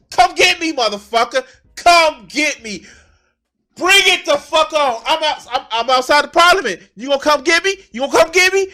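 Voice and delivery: loud, high, taunting; defiant wartime leader. Retold or imitated. Zelensky daring Putin, shouted high defiance. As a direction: dare the enemy to come. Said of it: "I'm like".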